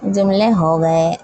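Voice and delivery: usual speaking voice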